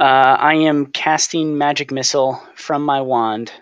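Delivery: normal talking voice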